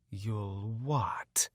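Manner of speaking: whispered, sneering challenge; mocking whispered threat. A narrator's reading. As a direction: hiss the taunt back at him. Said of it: "he said with a sneer".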